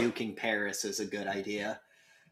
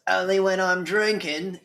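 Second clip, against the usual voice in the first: put-on cocky kid quip; 7 semitones higher, 6 dB louder than usual. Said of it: "And he goes".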